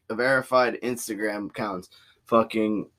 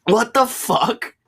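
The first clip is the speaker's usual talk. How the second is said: stunned disbelief; 9 semitones higher, much slower than usual. Real, not acted. Reacting to blast speed.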